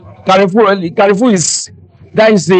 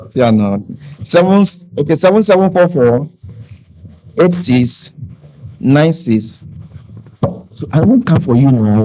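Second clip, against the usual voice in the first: usual speaking voice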